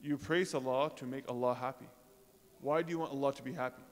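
usual speaking voice

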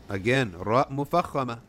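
normal talking voice

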